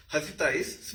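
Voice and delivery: usual speaking voice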